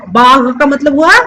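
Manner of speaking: ordinary delivery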